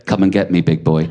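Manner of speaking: flirty come-on. Retold or imitated. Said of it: "a message that just read".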